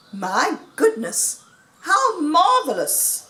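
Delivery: marvelling, high and drawn out; delighted exclamation. A narrator's reading. Animals reacting to Fox's invitation, delighted. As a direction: exclaim in wonder at the news.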